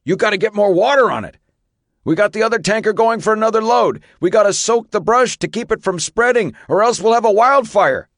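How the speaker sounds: high, loud, fast urgent yell; yelling over engines. Acted. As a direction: bark orders over the roar. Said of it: "the fire chief yelled over the hum".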